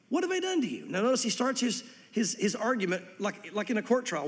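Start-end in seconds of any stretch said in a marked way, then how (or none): 0.1-0.8 pleading. Acted. God pleading with his people.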